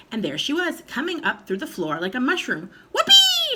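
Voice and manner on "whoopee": very high, loud whoop of joy; old grandma whooping with glee; whooping shout. A narrator's reading. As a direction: let out a wild whoop. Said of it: "she shouted".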